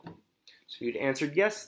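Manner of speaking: ordinary delivery, nothing marked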